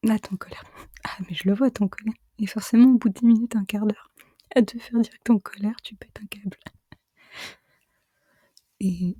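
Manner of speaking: hushed ASMR whisper. Real, not acted. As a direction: whisper it all, close to the mic. Avec ma voix chuchotée.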